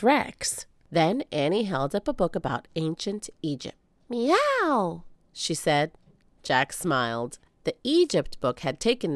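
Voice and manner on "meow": high playful meow; cat meow. Acted. Annie voiced by narrator.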